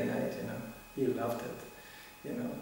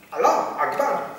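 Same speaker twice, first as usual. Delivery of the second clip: higher, louder, slow grim cry; mock angry cry; 8 semitones higher, 7 dB louder, slower than usual. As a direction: bark it out like an angry zealot. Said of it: "They wake up".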